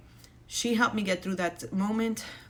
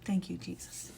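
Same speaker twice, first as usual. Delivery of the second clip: soft, unvoiced whisper; whispered thanks; 4 dB quieter than usual. Real, not acted. Mom closing her video with whispered thanks. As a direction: breathe a quiet prayer of thanks.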